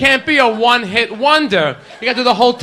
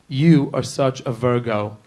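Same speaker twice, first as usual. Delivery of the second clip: low, slow pick-up line; mock seductive; 9 semitones lower, 5 dB quieter than usual. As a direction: drop it low and slow, smooth-talking.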